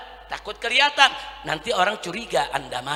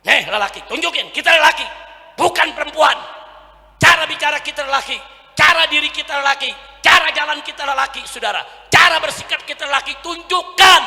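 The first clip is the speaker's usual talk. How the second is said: raised, hammering exhortation; full-force preaching; 7 semitones higher, 9 dB louder than usual. Real, not acted. Preacher exhorting young men, forceful rallying voice. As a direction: punch each repeated phrase to the crowd.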